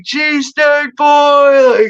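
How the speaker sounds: high, drawn-out crowd shout; hollered nickname. Retold or imitated. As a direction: holler it long and high like a chanting schoolmate. Everyone hollering kid's nickname.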